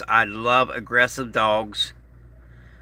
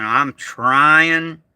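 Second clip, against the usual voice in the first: "trying" drawn out long and louder; stress on trying; 3 semitones higher, 3 dB louder than usual. Real, not acted. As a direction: lean hard on "trying", stretching it out. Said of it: "I emphasize the word try".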